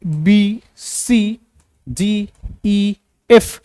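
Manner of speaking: slow, careful letter by letter; letters spelled out. Shown as a demonstration. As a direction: say each letter slowly and distinctly. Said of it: "ए है ना". (धीमे, अलग अलग अक्षर)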